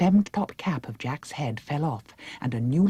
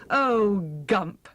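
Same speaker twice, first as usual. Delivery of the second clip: laughing; 5 semitones higher than usual. Acted. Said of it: "laughed Dorothy".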